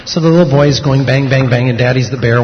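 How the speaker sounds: ordinary delivery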